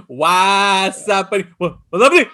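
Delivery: Chicano greeting. Retold or imitated. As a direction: stretch "What's happening?" lazily, Chicano style. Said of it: "the whole thing of the".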